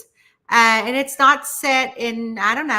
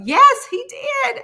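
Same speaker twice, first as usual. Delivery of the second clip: mock viewer insistence; 7 dB louder, slower than usual. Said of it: "y'all are like".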